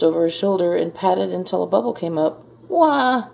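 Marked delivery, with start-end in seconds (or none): 2.7-3.3 high mock baby cry, falling; baby ghost's wail. A narrator's reading. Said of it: "yelled the baby ghost".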